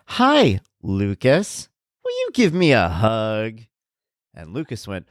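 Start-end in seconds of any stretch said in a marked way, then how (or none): 0.1-3.6 sing-song like a talking plush toy; toy dog voice. Retold or imitated. The toy dog Scout greets the boy in a chirpy voice. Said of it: "Scout goes".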